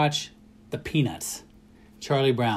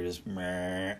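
low, flat-toned soft meh; monotone meh; 6 semitones lower, 9 dB quieter than usual. Shown as a demonstration. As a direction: drop it into a dull monotone. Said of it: "I'm a pretty monotone speaker".

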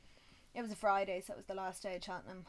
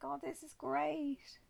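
giddy delight; 8 semitones higher, 3 dB louder, slower than usual. Retold or imitated. Drunk watching race.

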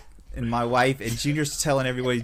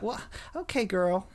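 mimicking Southern accent; 7 semitones higher than usual. Retelling how he copied the Southern glasses lady, in a Southern accent. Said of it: "found myself mimicking her voice".

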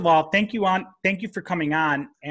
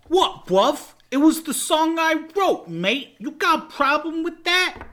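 high, defensive fake Cockney; shitty Cockney accent; 8 semitones higher than usual. Rocker defending his song to producer, bad Cockney accent. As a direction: challenge them in a hammed-up Cockney. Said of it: "such a shitty Cockney accent".

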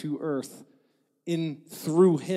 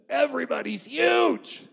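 higher, mock-alarmed yell; alarmed shout; 9 semitones higher than usual. Fearful spies exaggerating the giants to the people, mock shout. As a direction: blurt it out like a frightened spy. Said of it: "but they said".

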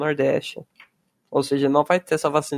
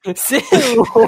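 shocked; 8 semitones higher, 7 dB louder than usual. Real, not acted. Shocked reaction.

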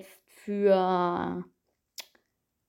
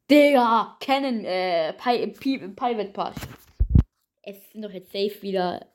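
normal talking voice

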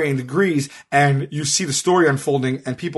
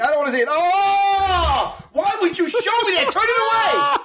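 disgusted shouting. Real, not acted. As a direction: recoil and yell to turn it away. Recoiling from a shown picture.

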